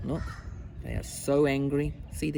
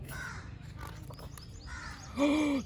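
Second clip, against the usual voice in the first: soft, breath-only storytelling gasp; shocked gasp; 10 semitones higher, 7 dB quieter than usual. Acted. Reader reacting to all the tigers, soft gasp. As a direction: suck in air in mock shock.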